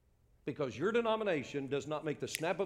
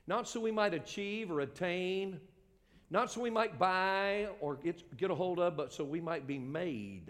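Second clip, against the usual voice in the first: emphatic preaching; 4 semitones higher than usual. Real, not acted. Preacher explaining grace to his congregation, forceful. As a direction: hammer each rejected idea to the congregation.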